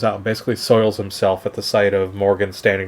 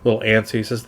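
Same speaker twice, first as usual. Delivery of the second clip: ordinary talk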